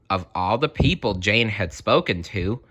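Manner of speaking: plain reading voice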